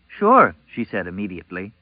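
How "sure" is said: very high, loud, quick agreement; eager. A narrator's reading. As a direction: jump at the offer. Eager girl answers at once.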